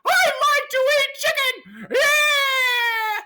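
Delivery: high, squeaky cartoon mouse voice with laughter; goofy high Mickey Mouse impression. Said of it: "My name is Mickey".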